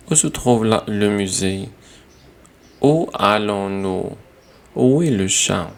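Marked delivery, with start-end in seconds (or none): none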